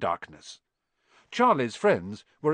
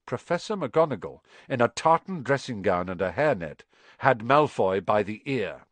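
ordinary delivery, nothing marked

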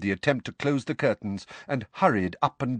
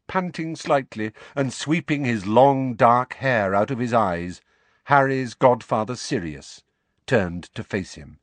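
ordinary delivery, nothing marked

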